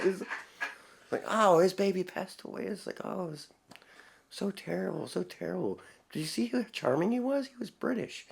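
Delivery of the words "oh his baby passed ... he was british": high, soft gushing imitation; hammed-up charmed listener; mock swooning. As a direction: coo with fake sympathy, high and quiet. Mocking a charmed listener's sympathy.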